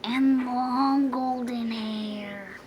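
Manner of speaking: slow, whispered sulk; whispered grumble. A narrator's reading. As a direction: breathe the complaint out slowly. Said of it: "grumbled the dragon".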